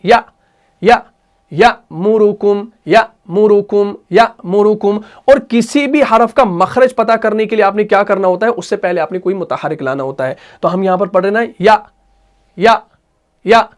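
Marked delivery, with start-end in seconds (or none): 0.0-5.0 abrupt "या" cut by a glottal stop, then slow "यअमुरुकुम"; hamza jerk drill. Shown as a demonstration. Teacher showing it read with a jerk, hamza drill. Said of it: "झटके के साथ पढ़ते हैं". 11.6-13.7 short "या" shut off by a throat catch; final hamza demo. Shown as a demonstration. Teacher showing where the final sound comes from, hamza jerk. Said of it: "आखिर में अ की आवाज कहां निकल रही है".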